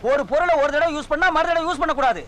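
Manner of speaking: usual speaking voice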